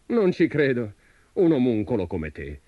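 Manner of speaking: scornful giant. A narrator's reading. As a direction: scoff at a puny challenger. Giant scoffs at the little tailor.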